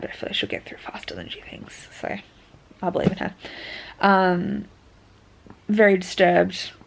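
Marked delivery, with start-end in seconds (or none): 0.0-3.3 whispering. Real, not acted. Whispered aside about friend.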